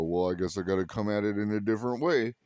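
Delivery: fast mock flat earther conceding. Flat earther realizing he proved the earth round, rushed mock. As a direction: rattle it off hurriedly. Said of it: "flat earthers ... go".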